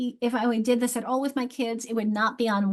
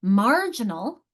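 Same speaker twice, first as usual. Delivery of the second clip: "Marginal" slowly, with a strong MAR; slow MAR-gin-al for "Marginal"; first-syllable stress; 4 dB louder, slower than usual. Shown as a demonstration. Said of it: "my strong is here".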